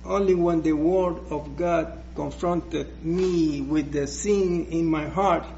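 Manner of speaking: ordinary talk, nothing marked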